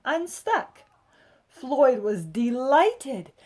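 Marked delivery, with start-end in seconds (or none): none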